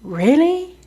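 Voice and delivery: high rising "Really?"; reacting to a suspicious quarantine claim; disbelieving. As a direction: sceptical surprise. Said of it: "And I was like".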